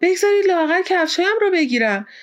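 high-pitched indignant protest; angry. A narrator's reading. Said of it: "پاسپارتو با عصبانیت گفت".

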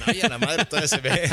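high, startled, laughing gasp of disbelief; mock amazement at how young she looks; amazed exclamation. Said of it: "de que dicen".

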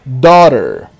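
Daughter. said "dó-rer" with a wide open O and a tapped R. Shown as a demonstration. Teacher models daughter with an open O. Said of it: "Com som de O bem aberto".

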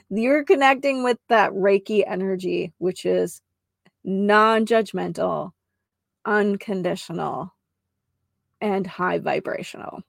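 measured restatement. Shown as a demonstration. Healer restating her mouthful for listeners, measured and spaced out. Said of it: "I'll say it like that".

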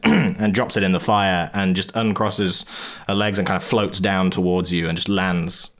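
ordinary delivery

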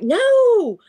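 pleading in a panic, begging her to stop; a dreamer begging a creature not to bite; panicked pleading. Retold or imitated. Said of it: "And I was like".

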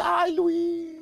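mock tender girl. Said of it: "pone cara de ternura y dice".

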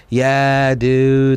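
slow, stretched-out surfer dude. Retold or imitated. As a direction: drag it out like an L.A. surfer. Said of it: "like, surfer L A voice".

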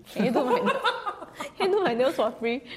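giggling through a cheeky request; laughing. Retold or imitated. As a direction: laugh while you ask. Laughing while retelling her ask.

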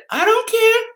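put-on very high squeaky kid voice; dad mimics his daughter in a high squeaky voice; imitating daughter. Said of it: "I did an impersonation of my daughter's voice".

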